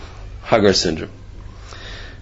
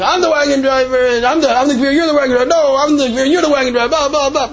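screaming argument; 12 semitones higher than usual. Retold or imitated. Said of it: "And they're screaming".

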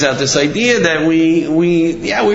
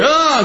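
high imitated groan; mock groan; 12 semitones higher than usual. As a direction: groan it out once more. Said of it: "again he's crying".

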